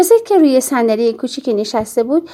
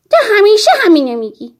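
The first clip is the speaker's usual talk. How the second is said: high, frustrated retort; child's indignant complaint; exasperated protest; 8 semitones higher, 4 dB louder than usual. A narrator's reading. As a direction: throw it back at her, pitched up. Child argues back at mother.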